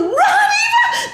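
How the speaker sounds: high, loud cheer, laughing, drawn out; cheering crowd. Retold or imitated. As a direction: cheer from the stands. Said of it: "They were going".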